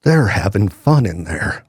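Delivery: low, disapproving manager voice; mock the stern boss; quoting the manager. Radio host mocking a disapproving old manager, low and dismissive. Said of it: "I have had a radio manager before say".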